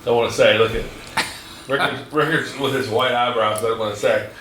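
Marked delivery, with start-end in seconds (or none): none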